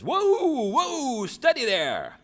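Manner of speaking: put-on high, loud jolly old man calling; cried the old man. Tom calming the panicked hobbits, high loud jolly call. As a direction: boom it out like a merry old fellow. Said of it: "cried the old man".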